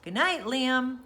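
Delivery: ordinary delivery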